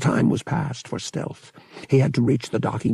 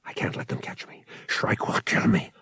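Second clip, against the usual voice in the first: panicked; 4 dB quieter than usual. A narrator's reading. Han panting as he runs.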